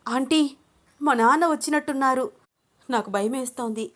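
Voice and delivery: high, alarmed voice; panicked. A narrator's reading. Daughter telling aunt her father has come, panicked. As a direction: raise the pitch in sudden alarm. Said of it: "కంగారు పడింది అను".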